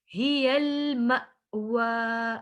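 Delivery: हियल मअवा chanted slowly, a catch after "ma", then long "waa"; jolt on the hamza. Shown as a demonstration. Teacher showing the jolt, मअवा with a catch and long ending. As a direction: stop sharply inside मअवा, then stretch the end. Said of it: "झटका किया था हमने".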